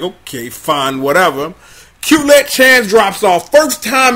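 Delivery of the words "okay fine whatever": dismissive. Retold or imitated. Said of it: "I'm just sitting up here like".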